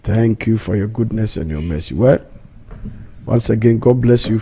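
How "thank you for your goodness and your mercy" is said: prayer. Acted. Opening prayer, spoken low.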